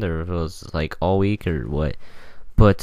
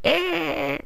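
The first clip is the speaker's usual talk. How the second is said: very high squeaked "Eh."; squeaky sad noise. Shown as a demonstration. Topping his sad voice demo, a very high squeak. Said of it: "And my voice gets higher too".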